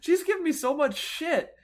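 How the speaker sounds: high, loud, upset telling of a bad visit; reliving the humiliation, voice climbing high and loud; worked-up retelling. Real, not acted. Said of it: "almost crying again".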